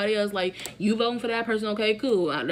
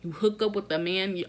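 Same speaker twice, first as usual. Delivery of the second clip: ordinary talk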